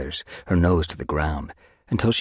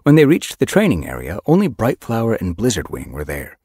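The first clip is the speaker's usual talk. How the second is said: ordinary delivery, nothing marked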